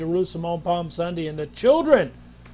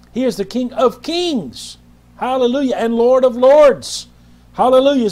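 higher and louder, rising praise; fiery preaching; 4 semitones higher, 5 dB louder than usual. Real, not acted. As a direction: preach it to the rafters. Preaching at full force.

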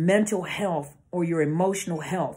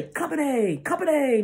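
military preparatory command; 8 semitones higher than usual. Shown as a demonstration. Speaker showing what troops hear, military command call. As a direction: call troops to attention in drill style. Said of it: "when you hear them say".

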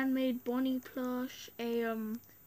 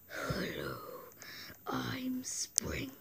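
whispered creepy animatronic threat; Springtrap voice; 7 dB quieter than usual. Acted. Kid gamer voicing Springtrap to viewers, whispered menace. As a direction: hiss it like a lurking animal robot. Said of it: "try and do a Springtrap voice impression".

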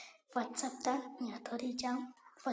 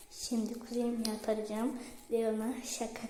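ordinary talk, nothing marked

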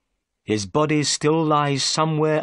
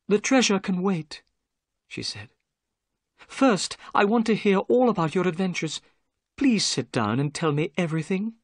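put-on higher, eager young woman's voice; keen to hear his adventures; gentle young lady; 8 semitones higher than usual. Miss Morstan greeting Watson, higher and eager.

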